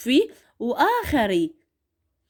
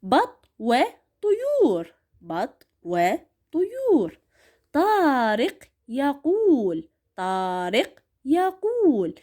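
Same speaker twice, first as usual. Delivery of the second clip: بط وطيور and طارق يقول read very slowly; drawn-out classroom reading; slow model reading; 3 semitones higher, slower than usual. Shown as a demonstration. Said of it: "راح أقرأ".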